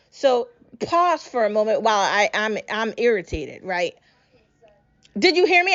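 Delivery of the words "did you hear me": demanding call-out. Real, not acted. Ranting at the listeners.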